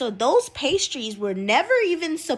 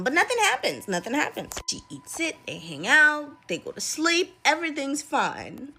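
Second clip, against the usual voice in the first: ordinary delivery, nothing marked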